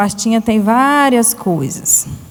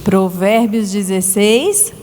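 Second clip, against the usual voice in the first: put-on slow, clear line for children to echo; slow verse for repeating; 3 semitones lower, slower than usual. Shown as a demonstration. Teacher leading children in a verse, slow and clear. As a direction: spell it out slowly so the kids can repeat. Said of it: "Vamos repetir comigo?".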